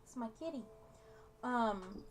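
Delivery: ordinary delivery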